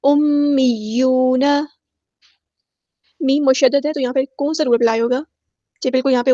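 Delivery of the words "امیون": slow, drawn-out Quranic recitation; Tajweed recitation. Shown as a demonstration. Teacher models the verse word, slow Tajweed recitation. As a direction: recite slowly, holding each long vowel. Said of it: "یا مشدد ہے تو اس کو جماؤ کے ساتھ ادا".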